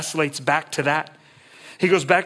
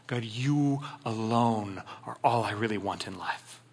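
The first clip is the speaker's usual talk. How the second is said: sincere quiet prayer; 5 semitones lower, 7 dB quieter than usual. Acted. Sincere closing prayer line.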